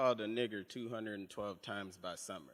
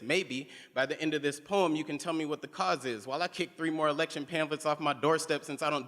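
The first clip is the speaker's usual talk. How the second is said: slam poem; 4 semitones higher, 6 dB louder than usual. Acted. Slam poem, building intensity.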